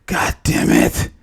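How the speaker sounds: slow, low, voiceless groan of words; rasped exasperation; pained cursing. Acted. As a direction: groan the curse out slowly. Character curses at being alive.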